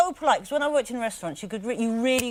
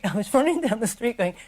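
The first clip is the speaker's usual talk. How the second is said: ordinary delivery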